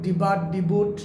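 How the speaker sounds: debut misread as "ডিবাট, ডিবুট"; common mispronunciations. Shown as a demonstration. Teacher listing learners' wrong debuts, common mispronunciations. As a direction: sound the t at the end of "ডিবাট, ডিবুট". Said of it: "অনেকে বলে".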